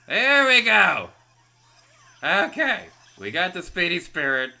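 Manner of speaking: loud, high, relieved cheer; triumphant cheer. Real, not acted. Gamer cheering after finally catching the spirit. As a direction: celebrate the catch.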